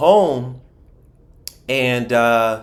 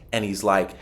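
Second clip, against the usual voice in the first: usual speaking voice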